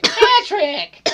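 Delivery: very high, loud, obnoxious cartoon squeal; SpongeBob. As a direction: shriek it as an obnoxious cartoon character. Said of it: "do the obnoxious SpongeBob voice".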